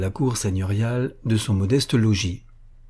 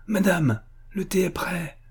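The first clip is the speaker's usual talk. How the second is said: joyful calling; 8 semitones higher than usual. A narrator's reading. Maid calls her mistress to tea, high joyful call. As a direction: sing out the news lightly. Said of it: "cria joyeusement Dacha".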